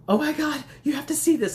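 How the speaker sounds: loud, high, urgent call to a friend; alarmed, insist the friend looks. Retold or imitated. Urgent video call to best friend.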